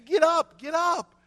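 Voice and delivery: high, loud urgent call; urgent shout. Retold or imitated. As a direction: yell at the boy to get up. Man urging stung boy.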